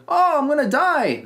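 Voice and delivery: hammed-up doomed moan; mock Tom Hardy panic. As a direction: pitch it way up and drag it out in fake panic. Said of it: "Tom Hardy going".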